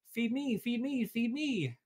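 high, insistent duckling; a hungry duckling demanding; hungry baby duck. A narrator's reading. Hungry duckling begs Jack, high and pleading. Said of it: "cried the hungry baby duck".

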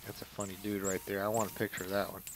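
normal talking voice

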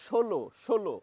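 ষোলো said as sholo with a sh; pronunciation demo. Shown as a demonstration. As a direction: say ষোলো with a sh sound, twice. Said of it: "উচ্চারণ হচ্ছে".

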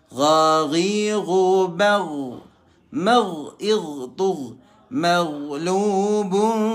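chanted with throaty gh sounds; Quran recitation. Shown as a demonstration. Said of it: "Hati-hati ya". (bacaan tajwid dengan ghin jelas)

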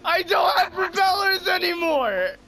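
wailing protest. Real, not acted. Player tells friends the propellers are gone, wailing protest. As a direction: wail it out in disbelief. Wailing over wrecked plane.

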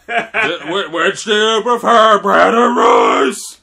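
loud, high, drawn-out question through laughter; laughing mock question. Playing the quizzer, asking a loud laughing question. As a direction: bellow the question while cracking up.

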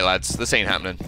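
ordinary delivery

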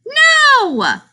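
ordinary delivery

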